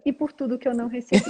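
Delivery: laughing aside. Acted.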